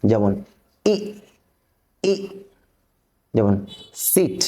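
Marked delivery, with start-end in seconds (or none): 0.9-2.1 short "ই", not drawn out; short vowel. Shown as a demonstration. Teacher demonstrating short vowels to learners, short "ই". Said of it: "আমরা টান দেব না". 4.1-4.4 "সিট" with a short i; short vowel. Shown as a demonstration. Teacher showing learners no lengthening, short "সিট". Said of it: "এখানে কোনো টান দেওয়া যাবে না".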